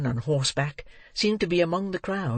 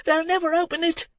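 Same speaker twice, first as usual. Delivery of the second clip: put-on urgent shouting boy. A narrator's reading. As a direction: shout them to the right room. Boy urging crowd to break in.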